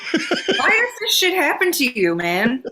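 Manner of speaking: high, slow, laughing disbelief. Real, not acted. Host laughing at her co-host's delivery mishap, high laughing disbelief. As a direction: laugh at her friend's bad luck. Laughing at friend's bad luck.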